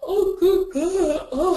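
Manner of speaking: high, loud, slow tired moan, close to crying; exhausted groan. Retold or imitated. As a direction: groan it out like you barely slept. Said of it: "It's like".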